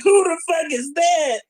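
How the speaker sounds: high, slow shouted outburst; bewildered fan yelling; mock shout. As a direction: yell it like a stunned crowd. Imagined crowd reacting, shouting.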